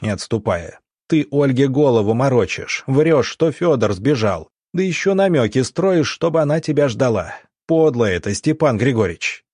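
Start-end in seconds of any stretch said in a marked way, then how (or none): 1.1-9.4 barked. Acted. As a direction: bark it right in his face. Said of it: "рявкнул Иван Палыч".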